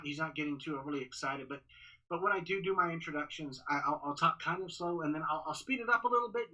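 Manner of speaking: ordinary delivery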